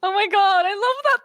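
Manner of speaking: usual speaking voice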